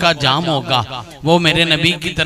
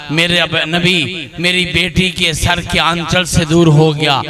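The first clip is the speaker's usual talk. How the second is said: ordinary talk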